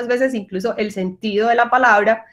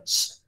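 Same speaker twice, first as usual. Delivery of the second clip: hushing shh, unvoiced; shushing; 10 dB quieter than usual. Shown as a demonstration. Teacher showing the sh sound by hushing. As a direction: shush someone into silence.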